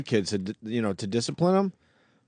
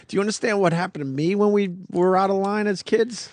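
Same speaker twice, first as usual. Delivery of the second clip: higher-pitched exasperated parent; retold frustration; 9 semitones higher than usual. Parent retelling his plea to a child in timeout, frustrated. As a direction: plead with an uncomprehending kid, exasperated. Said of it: "I sit there so frustrated".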